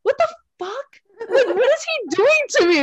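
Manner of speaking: high, exasperated retold outburst; mock outrage. Woman retelling her outrage at the long seminar hours. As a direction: squeal it out like you can't believe it.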